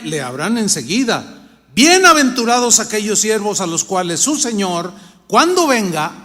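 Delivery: scripture reading. Acted. Preacher reading Luke to the congregation, loud and solemn. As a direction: proclaim each verse to the church.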